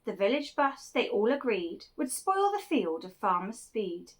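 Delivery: plain reading voice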